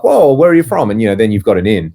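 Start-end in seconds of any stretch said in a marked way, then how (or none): 0.0-0.9 higher and louder, imitating a stranger's surprised delight. American stranger hearing an Australian accent, imitating surprised delight. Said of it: "They'd be like".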